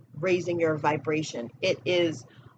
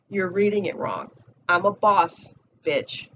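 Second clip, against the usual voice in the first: usual speaking voice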